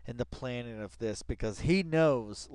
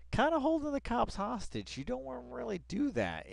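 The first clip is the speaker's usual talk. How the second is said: much higher, almost falsetto; high mock voice; 13 semitones higher than usual. Real, not acted. Streamer joking about the cops, high mock voice. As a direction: lift into a silly high voice.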